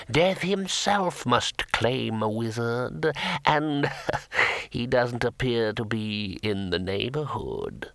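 slow, drawn-out ghostly gloating; voice of the grave. A narrator's reading. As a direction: intone it as a dead wizard, with a small cackle. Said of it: "in a voice of worms and sepulchres".

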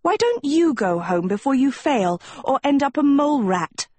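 put-on high-pitched, indignant girl; acted high-voiced huff; fuming. A narrator's reading. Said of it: "Sophie fumed".

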